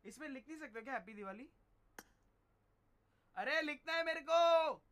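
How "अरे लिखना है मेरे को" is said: higher, louder, slow exasperated yell; frustrated shout. Real, not acted. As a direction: shout it out, fed up with the app. Frustrated he can't write a message. (झुंझलाकर ज़ोर से चिल्लाना)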